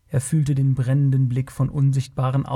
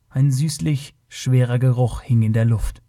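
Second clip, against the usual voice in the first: usual speaking voice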